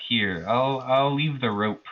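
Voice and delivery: ordinary talk, nothing marked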